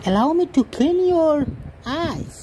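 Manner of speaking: high, slow, overly grand English to the cat; mock posh English. A pet owner courting his cat in grand mock English. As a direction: play the gallant gentleman, drawn out.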